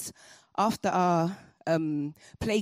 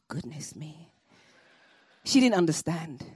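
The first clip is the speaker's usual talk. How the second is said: ordinary delivery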